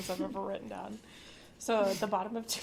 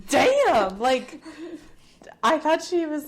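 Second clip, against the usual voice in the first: excited; 5 semitones higher, 10 dB louder, slower than usual. Retold or imitated. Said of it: "I was like".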